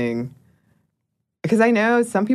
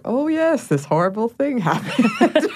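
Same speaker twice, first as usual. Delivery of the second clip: mock-casual delivery; 8 semitones higher than usual. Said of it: "they're delivered in such a way".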